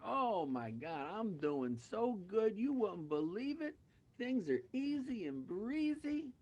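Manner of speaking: drawn-out, high-pitched raving; acted over-the-top delight; gushing elation. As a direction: stretch out the bragging with mock bliss. Joke character boasts of great life.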